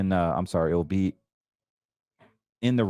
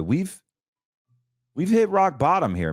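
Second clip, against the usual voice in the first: excited; 9 semitones higher than usual. Real, not acted. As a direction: call it out with excitement. Excited about the chart.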